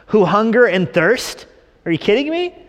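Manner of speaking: loud, high, rising disbelief; mock incredulity. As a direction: throw the words back as an outraged question. Mock reaction to the verse.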